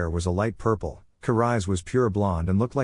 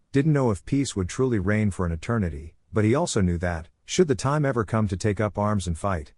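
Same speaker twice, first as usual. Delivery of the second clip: plain reading voice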